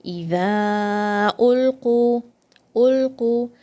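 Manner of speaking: إذا ألقوا with the long a stretched; stretched vowel. Shown as a demonstration. Tajweed teacher demonstrates the stretch in إذا ألقوا. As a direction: hold the mad in إذا, then ألقوا firm. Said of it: "So stretch this letter".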